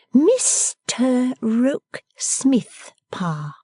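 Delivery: "Mister Rokesmith" drawn out slowly, syllable by syllable; separated syllables. A narrator's reading. Bella announcing her suitor, syllable by syllable. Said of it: "separating the syllables for emphasis".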